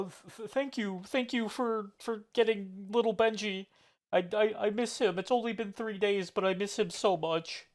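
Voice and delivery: soft, wavering gratitude, half-hammed; a soft touch of choked-up thanks; mock tearful. Shopkeeper thanking the party for finding Benji, mock tearful. NPC misses his pig.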